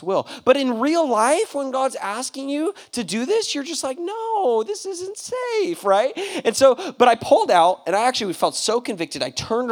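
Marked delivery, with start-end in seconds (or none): 4.0-5.8 high, drawn-out plea; mock protest. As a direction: resist like a reluctant kid, pitch way up. Protesting what God asks.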